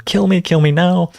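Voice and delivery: a light, playful mock yell. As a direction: plant begging pests, mild mock yell. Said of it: "it's yelling".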